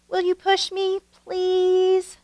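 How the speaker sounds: put-on a small child asking sweetly for a push; begging child. A narrator's reading. Nikki asking Grandpa Dan to push her, high and begging. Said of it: "Nikki begged".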